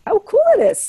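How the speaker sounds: excited echo. Shown as a demonstration. To friends, delighting in awareness, excited. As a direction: savor the word "cool" with delight. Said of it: "and how cool it is to be aware?".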